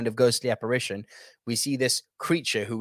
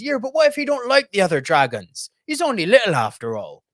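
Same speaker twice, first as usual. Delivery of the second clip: put-on a light Hagrid accent, higher and louder; Hagrid's accent; 7 semitones higher, 5 dB louder than usual. Hagrid fretting about his baby dragon, in Hagrid's accent. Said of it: "I hope that was a good enough accent".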